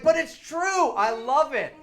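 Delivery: high, loud, gushing; squealing with joy over a stage trick; giddy defense. Retold or imitated. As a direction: gush about it like a kid. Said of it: "And I was like".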